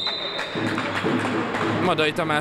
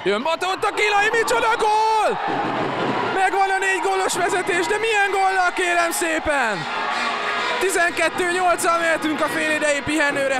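excited Hungarian sports commentary. Real, not acted. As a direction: yell the goal like a thrilled commentator. Goal call by live commentator.

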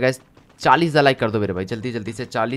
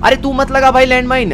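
high, loud and slowed, stretching the words out; alarmed shout; 10 semitones higher, 8 dB louder than usual. Real, not acted. Streamer yelling at friend not to plant the landmine, alarmed shout. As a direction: yell to stop him before he does it.

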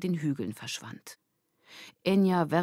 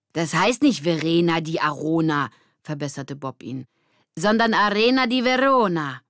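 correcting; 6 dB louder than usual. Acted. Bob correcting Mitch, know-it-all tone. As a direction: set him straight.

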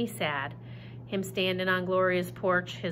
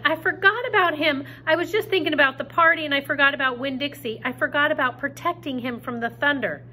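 panicked; 6 semitones higher, 5 dB louder, faster than usual. Acted. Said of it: "I shouted".

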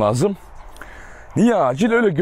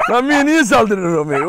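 surprised; 3 semitones higher, slower than usual. Real, not acted. Dog suddenly attacks.

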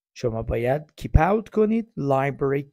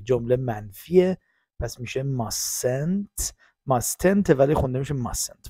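ordinary talk, nothing marked

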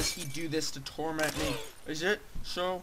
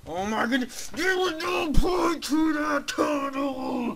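high, drawn-out loud wail; outraged shout; 10 semitones higher, 6 dB louder, slower than usual. Real, not acted. Gamer protesting the pointless tunnel, high outraged shout. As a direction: yell it high and stretch the words.

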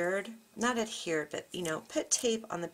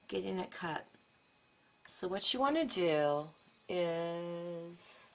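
ordinary talk

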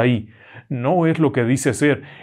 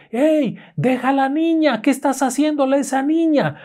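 aunt yelling; 13 semitones higher than usual. Acted. Aunt calling through the wall to the creature, high alarmed yell. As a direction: call out to stop what's happening. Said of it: "le grita".

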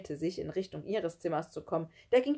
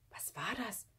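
hushed, much softer question; whispering; 4 semitones higher, 9 dB quieter than usual. A narrator's reading. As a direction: ask under your breath, pitch raised. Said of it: "flüsterte Christoph". (leise geflüstert)

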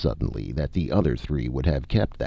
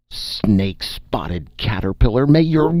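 furious curse; 8 semitones higher, 4 dB louder than usual. A narrator's reading. Condemned needlesmith cursing the spokesman before being silenced, furious. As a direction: spit the curse out.

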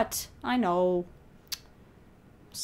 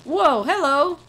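loud, swooping surprise; startled greeting; 4 semitones higher, 10 dB louder, slower than usual. Real, not acted. Player meets the stranger suddenly, loud surprise. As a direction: blurt it with bouncing pitch.

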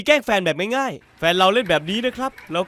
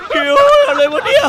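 high shriek into laughter; yelp, then burst out laughing; startled laugh; 9 dB louder than usual. Real, not acted. Friend startled by something in the water, laughing shriek.

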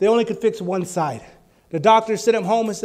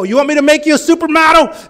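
higher, louder playful question; a louder, higher teasing question; excited question; 5 semitones higher, 6 dB louder than usual. Retold or imitated. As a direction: tease him, loud and high. Preacher retells his question.

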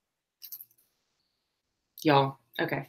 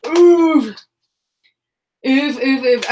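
loud, higher string of oofs; repeated oofs; 5 semitones higher, 7 dB louder, much slower than usual. Real, not acted. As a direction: keep going oof oof oof, louder. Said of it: "Yes, I said oof".

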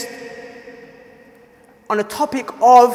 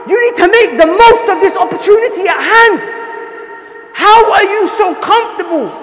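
louder, higher, slow scolding sermon; fiery rebuke; 7 semitones higher, 4 dB louder, slower than usual. Acted. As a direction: shake them out of their comfort. Rebuking the complacent.

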